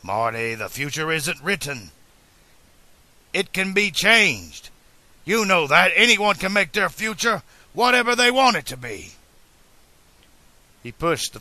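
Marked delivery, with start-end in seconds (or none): 0.0-9.1 building to a high, loud declaration; adamant. A narrator's reading. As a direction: argue with rising conviction. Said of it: "But the inventor was adamant".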